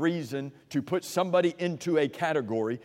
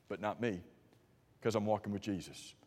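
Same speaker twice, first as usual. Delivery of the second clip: quiet conviction; 7 semitones lower, 11 dB quieter than usual. Real, not acted. Climax of the sermon point.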